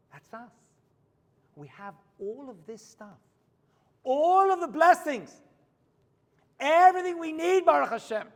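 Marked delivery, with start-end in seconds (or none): 4.0-8.3 fervent preaching. Acted. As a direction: preach it out to the congregation. Preaching at full force.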